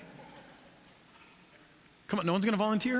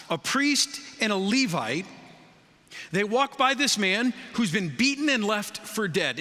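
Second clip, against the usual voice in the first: ordinary talk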